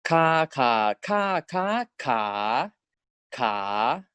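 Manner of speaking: "ka" stepped through five Thai tones; walk "ka" from level to rising. Shown as a demonstration. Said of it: "For example".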